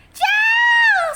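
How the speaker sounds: very high, loud squeal. A narrator's reading. Little brother squealing as Henry attacks. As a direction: squeal in alarm. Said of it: "squealed Peter".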